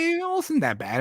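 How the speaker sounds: high-pitched mock reaction; pleasantly surprised. Fan recalling his own verdict on a film, pleasantly surprised. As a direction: pitch it right up, lightly defending the film.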